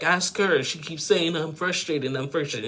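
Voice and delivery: acted-out exasperated tattling; retold exasperation. Acting out the man's exasperated complaint.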